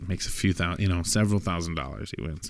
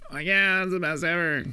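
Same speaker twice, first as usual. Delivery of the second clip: much higher, breathless with pleasure; ecstatic moan; 10 semitones higher than usual. Acted. John to the prostitute, a high ecstatic gasp. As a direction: swoon out the praise up high.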